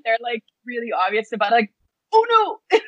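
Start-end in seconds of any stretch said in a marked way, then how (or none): 2.1-2.8 high-pitched, playful alarm; mock dismay. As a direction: act out being caught on camera. Acting out someone caught on camera.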